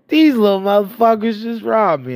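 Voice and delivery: mock outraged yell, pitched high; hammed-up shout of a robbed man; angry accusation. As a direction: imitate the furious man shouting. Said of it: "He goes".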